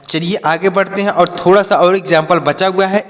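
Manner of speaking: ordinary delivery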